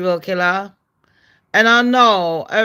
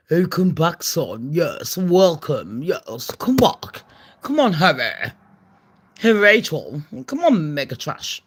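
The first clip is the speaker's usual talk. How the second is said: lightly voiced royal family; 5 semitones lower than usual. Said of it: "you want the family to be like".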